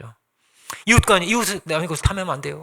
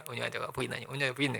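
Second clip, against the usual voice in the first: hushed verse recitation, barely voiced; murmured recitation; 6 semitones lower, 15 dB quieter, faster than usual. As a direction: murmur it low, as if memorizing to yourself. Reciting a verse while interrupted.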